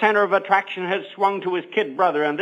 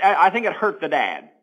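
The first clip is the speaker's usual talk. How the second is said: ordinary talk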